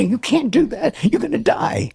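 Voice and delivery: hammed-up alarm, pitched very high; panicked doctor. Mock doctor warning a patient on Atkins, high-pitched alarm. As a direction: squeal it like a horrified doctor. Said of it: "And the doctor would say".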